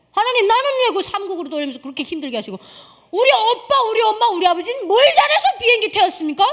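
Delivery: high, loud retold protest to God; indignant protest. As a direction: re-enact arguing with God, building to a loud, high complaint. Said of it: "하나님한테 한번 따졌어요".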